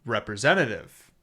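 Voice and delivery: usual speaking voice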